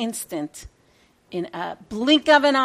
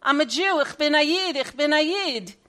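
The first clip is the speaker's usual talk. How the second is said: pleading yell, pitched up, that eases into insistent repeats; retold scream; 6 semitones higher, 4 dB louder than usual. Captured young man screaming at the Russian soldier, frantic pleading. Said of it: "I started to scream".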